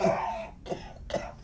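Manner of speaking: choking, sputtering cough; acted coughing. Drunk held down longer by preacher, acted choking cough. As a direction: come up coughing from underwater.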